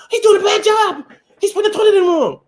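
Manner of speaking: hammed-up woman griping about a bad plumber; mock woman complaining. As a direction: gripe like a demanding customer. Mimicking woman complaining about worker.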